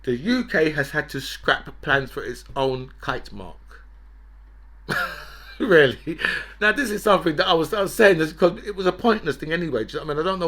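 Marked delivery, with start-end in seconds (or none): 4.9-5.5 a short, voiceless, high scoff of a laugh; breathy scoffing laugh. Real, not acted. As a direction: scoff at the news with an airy laugh. Laughs reading the kite mark story.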